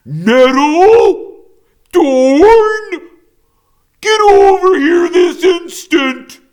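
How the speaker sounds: very high, very loud commanding yell; furious mother shout. A narrator's reading. Mother bear yelling at her fighting cubs, furious. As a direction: bellow at the cubs to come now.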